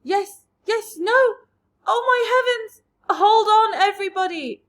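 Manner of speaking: high, loud urgent shout; calling out. A narrator's reading. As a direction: yell to everyone to hold tight. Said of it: "James called out".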